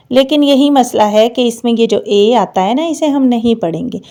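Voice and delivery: usual speaking voice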